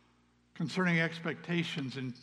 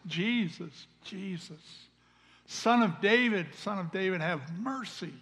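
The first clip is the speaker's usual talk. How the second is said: retold cry for mercy, raised and higher; loud calling out; 5 semitones higher, 5 dB louder than usual. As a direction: call out as the blind beggar pleading, without a full shout. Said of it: "call out in a very loud voice".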